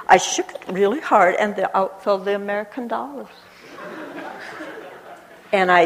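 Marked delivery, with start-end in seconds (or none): none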